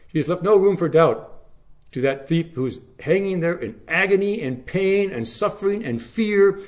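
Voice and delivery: high, loud impassioned sermon climax; full-force pulpit preaching; fervent preaching. Acted. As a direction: preach it out, rising and ringing.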